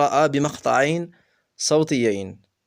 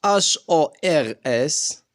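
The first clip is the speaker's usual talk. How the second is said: spelled letter by letter; much slower than usual. Shown as a demonstration. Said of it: "H هنا أصدقائي لا ينطق".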